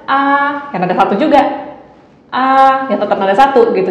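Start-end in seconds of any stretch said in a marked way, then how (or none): 0.1-0.7 "Ah" raised and held level; level first tone. Shown as a demonstration. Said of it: "Kok suara kamu rendah". 2.3-2.9 "Ah" raised and held level; level first tone. Shown as a demonstration. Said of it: "yang penting datar".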